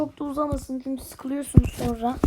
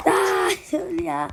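slow mock wail; 4 semitones higher, 3 dB louder, much slower than usual. Real, not acted. Gamer dies in game.

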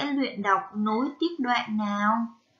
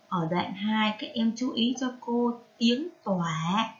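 ordinary delivery

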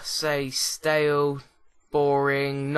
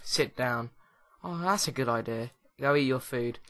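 normal talking voice

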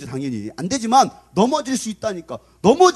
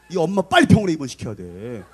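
ordinary delivery

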